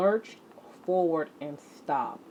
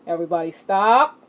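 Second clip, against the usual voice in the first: louder, quick call to halt; loud stop call; 9 dB louder, faster than usual. Acted. Teacher stopping the exercising class, loud and quick. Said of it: "calling the class to stop".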